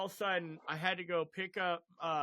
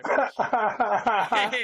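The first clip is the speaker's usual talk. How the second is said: laughing plea; 4 semitones higher, 10 dB louder, much slower than usual. Retold or imitated. Begging wife for hospital, laughing.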